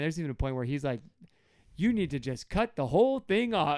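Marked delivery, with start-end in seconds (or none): 1.8-3.8 higher, emphatic mock outrage; angry Paul. Paul fed up in his letter to Galatians, mock angry. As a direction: lay down an exasperated demand. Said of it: "read it as angry Paul".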